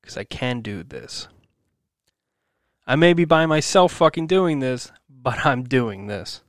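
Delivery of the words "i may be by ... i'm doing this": louder, higher burst of determination; heated self-rallying outburst; angry defiance. Real, not acted. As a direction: push it out, venting real frustration. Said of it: "I'm just angry".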